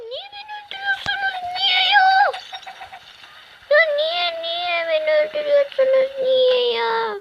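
very high squeaky whiny voice; mock whine. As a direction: whimper like a wronged child. Whining after repeated deaths. (çocuk gibi tiz sızlanma)

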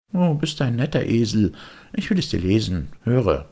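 put-on high, know-it-all kid; a cocky boy brushing him off; smug boyish voice; the boy's voice. Boy reads poster aloud.

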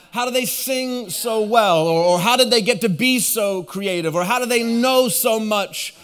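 high, energetic sermon delivery; impassioned preaching. Real, not acted. Preacher telling congregation about great communicators, lifted preaching voice. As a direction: preach it out to the whole room.

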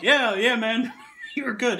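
loud, high, breaking into laughter; laughing through it. Real, not acted. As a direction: try to reassure him while cracking up. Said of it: "And I am dying laughing".

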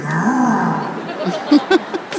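long mock growl sent back; retaliatory growl. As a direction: growl back in an energy war. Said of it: "I just started sending him back".

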